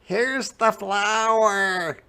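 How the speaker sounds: put-on high, slow, bright boy's call; excited Jack shout. A narrator's reading. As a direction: call out like an eager little boy. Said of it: "shouted Jack".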